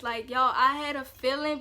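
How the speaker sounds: ordinary talk, nothing marked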